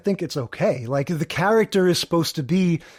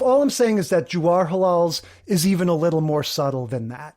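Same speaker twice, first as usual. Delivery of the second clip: usual speaking voice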